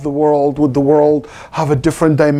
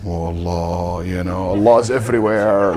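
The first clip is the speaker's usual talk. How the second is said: mock dua; 10 semitones lower, 5 dB quieter than usual. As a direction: drone it out like an overdone solemn supplication. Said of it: "nobody ever make dua like this".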